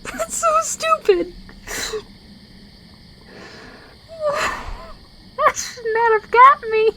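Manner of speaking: high giggly laughing fit; laughing too hard to talk; real laughter. Real, not acted. Player cracks up at a silly carving, real laughter. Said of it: "I don't know why that made me laugh".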